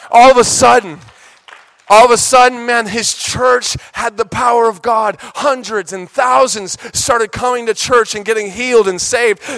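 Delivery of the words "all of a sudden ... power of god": loud, high preacher's cry; all of a sudden, shouted high; preaching at full force. Acted. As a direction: build the crowd up to the climax. Preaching climax.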